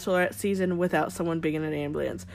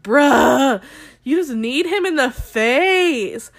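loud, higher, drawn-out disbelief; incredulous outburst; 4 semitones higher, 7 dB louder, much slower than usual. Acted. Host calling out a contestant's excuse, loudly incredulous. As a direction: blast him for the absurd excuse, stretching the words. Her incredulous retort to Luke P.

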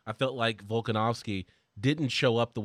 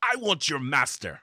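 bad movie dubbing; 2 semitones higher than usual. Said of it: "my bad dub impression".

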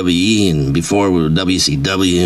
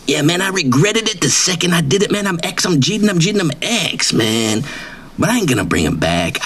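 pitched-up, swaggering braggart voice; cocky wrestler persona; 8 semitones higher than usual. Acted. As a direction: brag like a washed-up wrestling star who thinks he's famous. Persona answers question.

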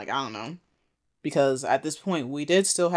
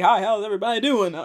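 awkward laughing greeting; 7 semitones higher, faster than usual. Retold or imitated. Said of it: "He's like".

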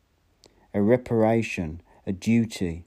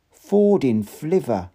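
swearing; 5 semitones higher, 5 dB louder, slower than usual. A narrator's reading. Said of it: "he swore".

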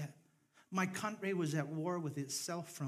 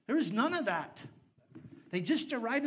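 ordinary delivery

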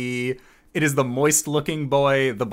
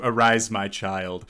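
normal talking voice

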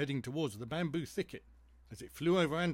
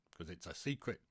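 low, hushed confidence; confiding secret; 6 semitones lower, 5 dB quieter than usual. Acted. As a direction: drop pitch and volume to share a secret.

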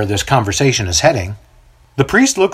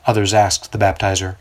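ordinary delivery, nothing marked

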